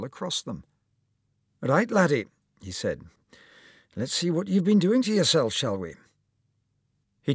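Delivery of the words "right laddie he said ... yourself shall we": put-on higher, louder, hearty old surgeon voice; lightly voiced cheery doctor; kindly old surgeon. A narrator's reading. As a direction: play the jolly surgeon greeting his patient. Surgeon McCulloch speaks.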